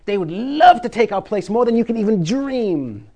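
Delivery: fervent, emphatic preaching; enthusiastic. Real, not acted. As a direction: push it with all the enthusiasm you have. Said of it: "I can't even say it with enough enthusiasm".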